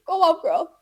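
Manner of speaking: high, loud, strained act of encouragement; mock egging-on. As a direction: squeak it out as if barely coping. Acting out the freaked-out reaction.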